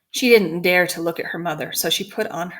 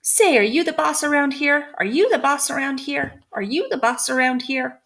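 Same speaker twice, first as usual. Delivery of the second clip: high, loud squawking bird demanding; a parrot shouting its challenge, higher; hammed-up squawking parrot yell; 7 semitones higher, 3 dB louder than usual. A narrator's reading.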